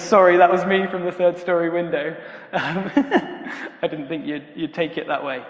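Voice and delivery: acted sheepish confession through chuckles; sheepish, laughing apology. Youth worker confessing the prank to Jacob, sheepish and laughing. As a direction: own up awkwardly, giggling between phrases. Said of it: "a very sheepish youth worker wanders in".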